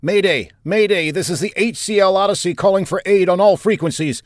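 high, loud mayday over the radio; frantic distress call. Acted.

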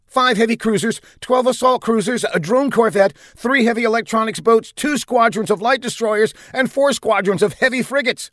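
high, tense officer's report; urgent bridge officer. Acted. Bridge officer listing enemy ships to commander, urgent. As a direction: call out each item urgently.

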